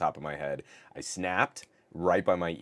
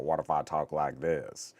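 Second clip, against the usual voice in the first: lowered sultry cool guy voice; 2 semitones lower than usual. Retold or imitated. A hint of cool guy voice to the chat. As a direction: put on the smooth sexy voice. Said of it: "And you're like".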